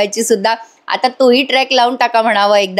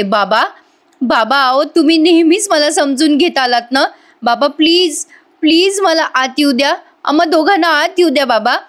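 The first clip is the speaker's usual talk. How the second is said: whining plea; 5 semitones higher than usual. Retold or imitated. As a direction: beg to be let back in. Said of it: "Priya ... म्हणते". (बाबांकडे लाडिक नाटकी विनवणी)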